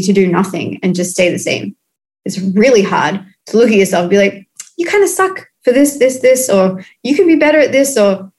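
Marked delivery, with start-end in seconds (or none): 4.8-6.5 higher, mock-scolding inner voice; half-playful self-reproach; mock harsh self-talk. 7.0-8.0 higher, mock-scolding inner voice; half-playful self-reproach; mock harsh self-talk.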